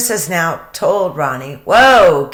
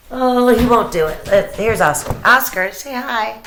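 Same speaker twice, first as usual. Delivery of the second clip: coaxing dog; 6 semitones higher, 3 dB louder than usual. Real, not acted.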